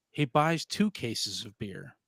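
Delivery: slow deadpan punchline. Acted. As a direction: drag out the punchline. Dad joke punchline slow.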